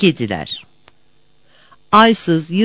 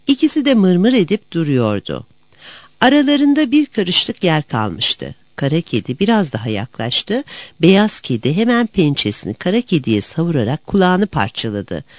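plain reading voice

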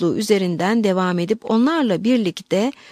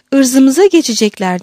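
loud, higher panicked exclamation; explosive outburst; 4 semitones higher, 7 dB louder than usual. A narrator's reading. Margarita echoing the abbess's fear, explosive cry. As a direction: burst out like a volley of gunfire. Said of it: "yaylım ateşi gibi patlayarak".

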